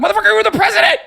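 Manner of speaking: rising into a shrieking yell; incredulous shouting rant; exasperated yell. Real, not acted. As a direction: yell it in disbelief at him. Streamer yelling at speech.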